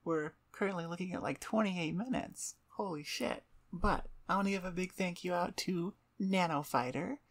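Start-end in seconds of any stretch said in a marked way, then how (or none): none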